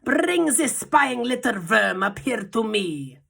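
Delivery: high, loud, slow contemptuous witch voice; Grand High Witch sneering shout. A narrator's reading. Witch leader ordering the captured boy brought to her. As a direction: spit out the insult slowly. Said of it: "shouted the Grand High Witch".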